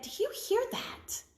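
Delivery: hushed, high scared whisper; child whispering in fear; frightened whisper. A narrator's reading. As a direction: whisper so the witch won't hear. Children hear the witch coming.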